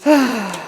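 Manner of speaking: heavy exhaled sigh; audible weary sigh. Real, not acted. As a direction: let out one long, falling sigh. Pauses after dismissing the viewers.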